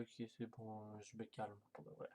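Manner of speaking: very soft, low and subdued; hushed, low self-soothing; calming down. Real, not acted. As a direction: settle right down, almost fading away. Calming down for real after outburst.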